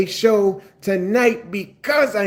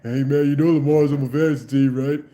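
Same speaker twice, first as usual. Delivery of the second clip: imitating Chiefs fan; 6 semitones lower than usual. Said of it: "he kept saying".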